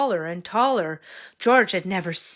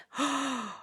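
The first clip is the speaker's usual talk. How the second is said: gasp; 6 semitones higher, 7 dB quieter than usual. Acted. Reacting to mishap.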